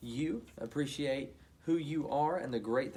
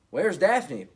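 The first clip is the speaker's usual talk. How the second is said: louder, anxious question; 4 semitones higher, 11 dB louder than usual. Acted. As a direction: ask in a worried rush. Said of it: "asked anxiously".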